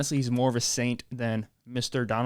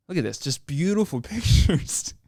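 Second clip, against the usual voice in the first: cracking up; 4 semitones higher, 4 dB louder than usual. Real, not acted. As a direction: try to say it, burst out laughing. Cracking up at the photos.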